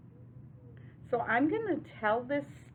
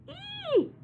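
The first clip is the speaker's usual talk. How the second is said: very high piggy "ee"; short, high-pitched pretend squeal; 6 dB louder than usual. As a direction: squeal like a storybook pig. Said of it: "The pig squeals".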